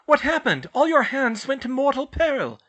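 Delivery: loud, frantic questions; panicked mother. A narrator's reading. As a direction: demand answers in panic.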